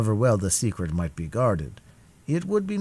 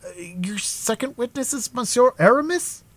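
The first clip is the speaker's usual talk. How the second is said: astonished. A narrator's reading. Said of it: "in an accent of greater astonishment".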